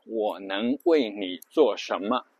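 我能为你做什么 slowly, each syllable its own beat; measured, syllable-by-syllable 我能为你做什么; syllable-timed rhythm. Shown as a demonstration. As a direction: give every syllable of 我能为你做什么 equal space. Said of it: "一个字就是".

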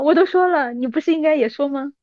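ordinary talk, nothing marked

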